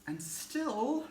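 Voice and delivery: high, loud, drawn-out ranting that keeps rising; building frantic outburst. Acted. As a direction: tell it as if losing all control. Frustrated retelling builds toward the scream.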